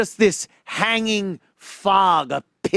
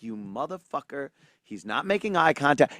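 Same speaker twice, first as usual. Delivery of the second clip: under-breath curse; 3 semitones lower, 7 dB quieter than usual. Retold or imitated. Fuming silently at cohost.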